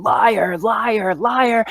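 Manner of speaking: possessed screaming. Acted. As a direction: scream it back while running away. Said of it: "as she's screaming this".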